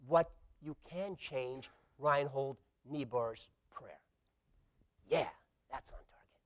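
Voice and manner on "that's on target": hushed approving aside; whispered aside. Real, not acted. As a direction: whisper it under your breath. Quiet aside.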